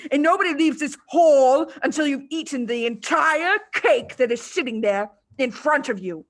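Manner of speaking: loud, bullying headmistress rant; loud angry Trunchbull lecture; furious tirade. A narrator's reading. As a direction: berate the boy from the platform. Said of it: "the Trunchbull exploded".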